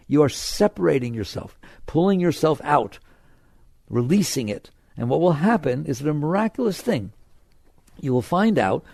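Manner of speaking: normal talking voice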